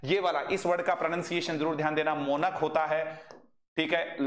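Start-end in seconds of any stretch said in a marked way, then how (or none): 2.2-2.5 मोनक said slowly, ending in a plain k sound; drawn out मोनक, final क clearly heard; careful pronunciation. Shown as a demonstration. Teacher stresses to students how मोनक ends. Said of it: "सीएच का pronunciation क होता है".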